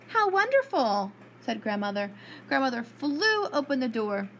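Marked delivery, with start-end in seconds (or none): 0.1-1.1 high, bright delight. Acted. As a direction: gush with joy. Grandmother delighted at door.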